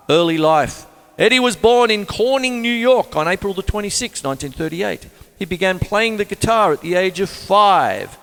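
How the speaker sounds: reading the facts out like a radio presenter; read-aloud biography. Acted.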